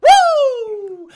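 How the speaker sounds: a huge high whooping cheer; loud celebratory woo. Pretend. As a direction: whoop like a rally crowd. Cheering his own campaign.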